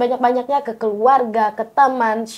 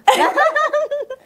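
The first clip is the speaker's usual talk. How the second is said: high-pitched burst of laughter. Real, not acted. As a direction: laugh high after your own joke. Laughs after subscriber joke. (tawa bernada tinggi)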